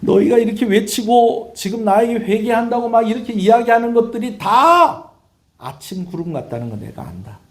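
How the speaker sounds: high, loud preaching cry; forceful rebuke. Acted. God to people claiming to repent, forceful rebuke. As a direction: thunder the charge, drawing out the end.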